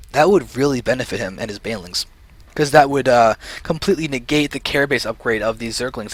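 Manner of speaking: ordinary talk, nothing marked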